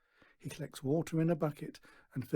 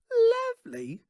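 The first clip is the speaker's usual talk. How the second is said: very high, loud scoffing cry; scornful snort; 10 dB louder, slower than usual. A narrator's reading. Daughter scoffing at Stan's praise, high loud scorn. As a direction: spit the word back. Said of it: "snorts Natasha".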